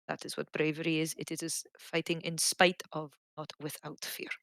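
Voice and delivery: soft, hushed and unhurried; gentle advice. Acted. To the little lad with sweets, soft tender advice. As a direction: quietly pass on wisdom to a child.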